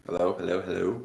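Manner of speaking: soft sound check hellos; quiet testing voice; mic check at low volume. Shown as a demonstration. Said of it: "just make it louder or lower".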